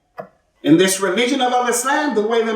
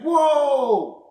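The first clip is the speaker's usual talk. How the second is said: amazed whoa; 4 semitones higher than usual. Retold or imitated. Crowd reacting amazed.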